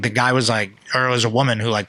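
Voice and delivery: ordinary talk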